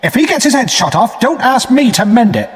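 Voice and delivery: a sharp, high scolding sister. A narrator's reading. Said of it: "my sister said sharply".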